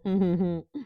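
short high amused laugh; light giggle; fond little laugh. Acted. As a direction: laugh tenderly at the little flower. Tree laughs fondly.

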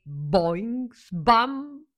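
high boing, then loud slam; imitated bounce and crash. A narrator's reading. Narrator imitates jump and crash.

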